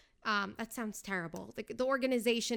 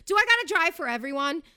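higher, louder fed-up cry; exasperated outburst; 5 semitones higher, 7 dB louder than usual. Retold or imitated. Stuck in LA traffic, a fed-up louder outburst. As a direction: vent it at the traffic. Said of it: "and I went".

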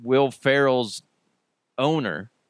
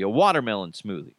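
ordinary delivery

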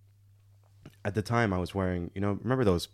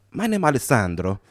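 put-on comic Italian-accented English; 2 semitones higher, 3 dB louder than usual. Italian driver introducing himself, comic Italian accent. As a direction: introduce yourself like a cartoon Italian. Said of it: "the comically stereotypical accent".

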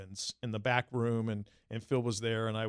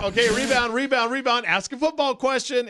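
much higher, louder frantic self-talk; panicked inner voice; 11 semitones higher, 7 dB louder than usual. Retold or imitated. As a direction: scramble to save the moment.

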